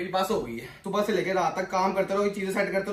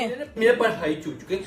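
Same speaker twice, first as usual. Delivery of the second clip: mocking imitation. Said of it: "अरे मेरी नकल मत उतारे".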